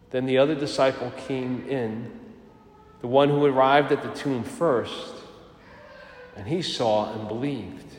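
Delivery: measured liturgical reading; Gospel reading. Real, not acted. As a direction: read it like proclaiming scripture. Proclaims the Gospel.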